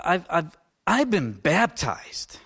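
ordinary talk

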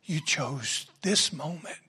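soft whispered prayer of thanks. Shown as a demonstration. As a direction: breathe your thanks to God. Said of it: "the first impulse should be".

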